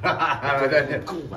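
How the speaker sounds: relieved laughing words. Real, not acted. Laughing after prank reveal.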